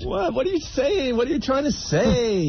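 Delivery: very high, squealed questioning; mock bewilderment. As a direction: plead for sense in a high voice.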